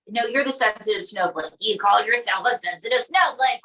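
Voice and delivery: usual speaking voice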